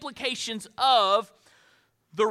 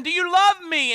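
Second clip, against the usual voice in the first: higher and louder, stressing love; pleading question; 8 semitones higher, 6 dB louder than usual. Retold or imitated. Jesus asking Peter the third time, loud and pleading. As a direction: push hard on "love", urging.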